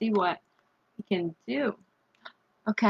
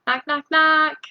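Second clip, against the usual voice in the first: mock door knock; 5 semitones higher than usual. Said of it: "You go".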